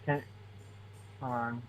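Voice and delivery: faint, barely audible voice; really quiet. Real, not acted. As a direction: speak very quietly, far from the mic. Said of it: "Hold on, you're really quiet".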